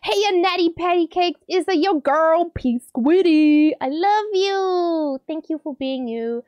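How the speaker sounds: high, drawn-out gushing; exuberant message reading. Acted. Streamer reading a friend's birthday love, exuberant. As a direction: stretch the ends high and playful.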